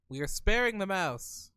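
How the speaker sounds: normal talking voice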